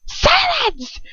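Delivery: high, sharp order to be silent; furious command. Acted. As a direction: cut her off, pitched high. Bellatrix commands.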